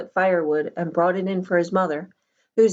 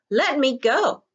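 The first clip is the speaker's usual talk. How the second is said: sharp; 8 semitones higher, 4 dB louder, slower than usual. A narrator's reading. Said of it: "Charles said sharply".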